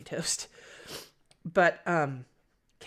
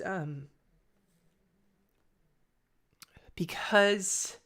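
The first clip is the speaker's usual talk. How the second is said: choked whisper through tears; barely gets the word out, teary; after crying; 4 semitones higher, much slower than usual. Real, not acted. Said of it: "getting really teary-eyed".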